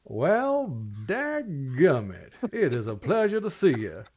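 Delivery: put-on hearty old-fashioned Southern welcome; folksy Southern lord president. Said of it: "and he says".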